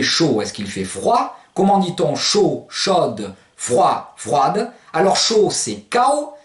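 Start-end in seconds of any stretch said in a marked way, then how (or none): 5.9-6.3 CAO ending on the glide, no D heard; higher cao, final d left silent. Shown as a demonstration. Teacher giving masculine hot, silent-D pronunciation. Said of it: "vous ne faites pas claquer le D".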